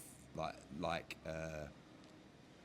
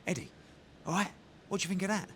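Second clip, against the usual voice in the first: higher, quick, cocky voice; imitating David Haye; 11 semitones higher, faster than usual. David Haye asking Eddie what he thought, quick cocky imitation. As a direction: tease him, pleased with yourself. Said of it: "He went".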